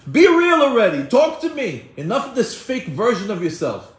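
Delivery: high, loud outburst easing off at the end; acted shout of a fed-up father figure; exasperated demand. God breaking a person down to make him real, exasperated shouting.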